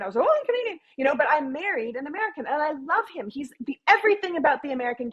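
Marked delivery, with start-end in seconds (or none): 0.2-0.8 bright, much higher chirpy reply; proudly Canadian. Retold or imitated. Traveller abroad answering locals who ask her nationality, chirpy and much higher.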